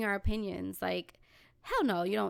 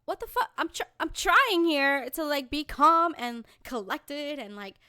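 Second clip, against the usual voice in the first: high, strained-patience outburst; exasperated; 4 semitones higher, 3 dB louder than usual. Acted. Speaker trying to reason with friend, exasperated. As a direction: lose patience while insisting on patience.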